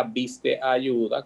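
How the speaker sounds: normal talking voice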